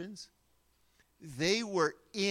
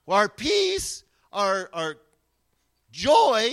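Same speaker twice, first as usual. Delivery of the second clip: preaching peak; 9 dB louder, slower than usual. Real, not acted.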